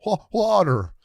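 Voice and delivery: high, loud, needy plea; mock desperation for water. Tripping man wandering for water, hammed-up desperate plea. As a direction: act out a parched plea. Acting out staggering in search of water.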